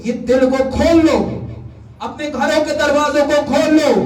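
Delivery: high, insistent exhortation; urgent preaching. Real, not acted. Preacher urges congregation to open their hearts, high and urgent. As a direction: drive each appeal home in a high preacher's pitch.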